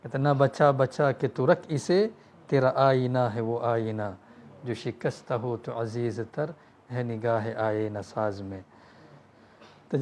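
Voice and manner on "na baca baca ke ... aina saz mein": slow, measured recitation of an Urdu couplet; recited poem. Acted. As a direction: draw each line out slowly like verse. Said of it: "satu syair yang Allama Iqbal". (bacaan syair perlahan dan berirama)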